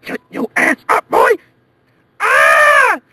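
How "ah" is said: high loud shriek; panicked scream. Acted. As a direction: scream in terror.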